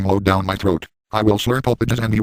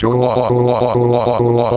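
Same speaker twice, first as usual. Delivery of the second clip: exaggerated louder mock moans; mock moaning; 2 semitones higher, 4 dB louder than usual. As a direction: moan it out loud as a joke. Mock pleasure moaning in story.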